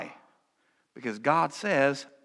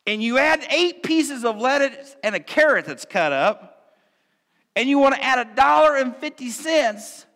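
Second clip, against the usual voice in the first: a higher, louder mock-indignant rant; comic outrage; 9 semitones higher, 7 dB louder than usual. Real, not acted. Preacher ranting about the Dairy Queen, in comic outrage. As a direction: rant like you've been robbed of your fries.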